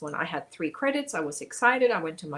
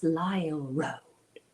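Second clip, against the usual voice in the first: slow, whispery "Lyle Road"; mock the narrator with a low, drawn-out whisper; hushed, low narrator impression; imitating audiobook narrator; 6 semitones lower, 4 dB quieter, much slower than usual. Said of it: "that's how he said it".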